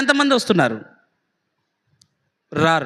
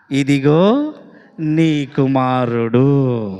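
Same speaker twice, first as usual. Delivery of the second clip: Jesus handing back the son; 10 semitones lower, much slower than usual. Acted. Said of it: "ఏమన్నాడు".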